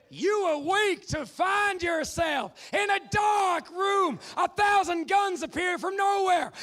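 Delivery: slow, loud, high game-show narration; dramatic announcer. Acted. Host opens the dungeon round, slow and booming.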